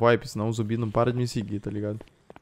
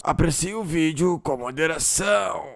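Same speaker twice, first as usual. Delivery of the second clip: drawn-out, higher-pitched sign-off line; announcer-style catchphrase; 4 semitones higher, slower than usual. Acted. Vlogger launches the video for viewers, mock-announcer drawl. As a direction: stretch it out like a slogan.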